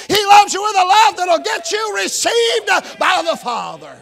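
high, loud, shouting preacher's climax; full-force preaching. Acted. Pastor proclaiming God's love to the congregation, shouting and climbing.

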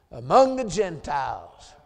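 usual speaking voice

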